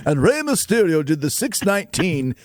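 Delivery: lightly mocking a stuffy Shakespearean reader; a hint of a pompous stage actor misreading. Host mimics the actor who botched his audiobook, lightly pompous. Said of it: "some Shakespearean actor to read my book".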